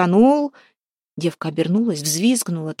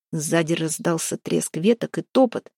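usual speaking voice

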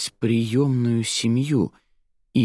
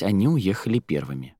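ordinary delivery

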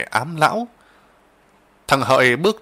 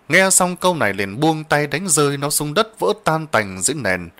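ordinary delivery

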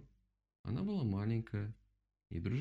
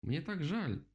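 higher-pitched, relieved exclamation; exclaiming; 7 semitones higher, slower than usual. A narrator's reading. Rabbit hopping with relief as he apologises, exclaiming. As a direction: burst out with a heartfelt apology. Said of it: "воскликнул кролик".

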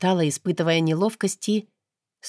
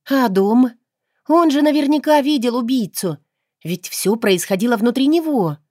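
eager reasoning; 6 semitones higher, 4 dB louder than usual. A narrator's reading. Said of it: "рассуждая, предположила я".

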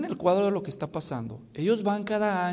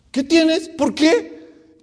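loud, high-pitched questions; hammed-up husband demanding answers; mock clueless questioning; 13 semitones higher, 13 dB louder, much slower than usual. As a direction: pester loudly in a high voice.

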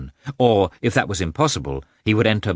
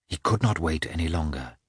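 ordinary delivery, nothing marked

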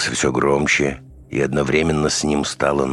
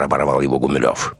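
ordinary delivery, nothing marked

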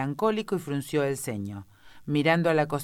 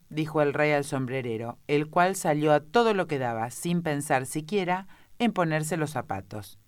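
usual speaking voice

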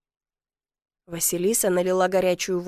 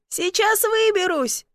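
high, drawn-out protest; indignant shout; 10 semitones higher, slower than usual. A narrator's reading. Stuck man shouting from the chimney, indignant shout. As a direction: call out, insisting you are fine. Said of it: "возмущённо крикнул я из трубы".

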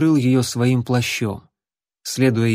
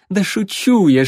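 laughing; 7 semitones higher, 4 dB louder than usual. Acted. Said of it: "девушка засмеялась".

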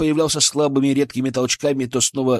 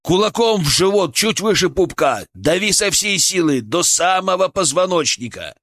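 higher, urgent commands; urgent instructions; 6 semitones higher than usual. A narrator's reading. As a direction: drive the orders home. Urgent instructions during resuscitation.

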